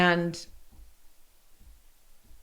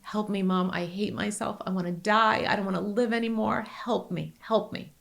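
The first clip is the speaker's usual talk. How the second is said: softer, desperate hushed plea; pleading cry for help; 4 dB quieter than usual. Retold or imitated. Mother voicing her son's hidden plea for help. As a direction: beg quietly, almost breaking. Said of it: "What he was really screaming was".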